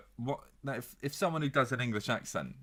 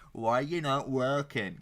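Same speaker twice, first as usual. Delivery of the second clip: lightly mimicked teacher, slow and a bit louder; telling-off teacher; 3 semitones higher, 4 dB louder, slower than usual. A teacher challenging two idle pupils, mildly scolding. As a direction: imitate a teacher checking up on pupils.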